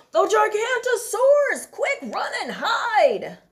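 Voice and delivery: high loud alarm shout; cry of alarm. Acted. Bonehead crying out a false alarm again. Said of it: "Bonehead cried".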